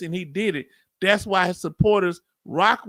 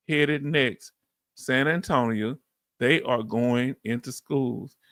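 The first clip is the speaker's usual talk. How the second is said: slow, low read-out of a comment; reading aloud; 7 semitones lower, slower than usual. Acted. Host reads a sister's comment to listeners, slow measured reading. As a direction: read it out slowly and deliberately, low.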